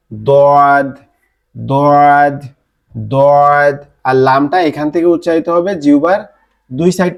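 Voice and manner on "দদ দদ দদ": দদ from the tongue side against the molars, heavy and firm; Arabic dad demo. Shown as a demonstration. Teacher drills the letter dad for students, heavy and firm. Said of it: "লেগে উচ্চারিত হবে".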